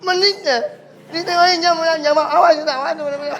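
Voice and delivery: nasal man scolding the silent stranger; mock nasal scolding. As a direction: threaten him in a muffled nasal voice. Nasal man grumbling angrily.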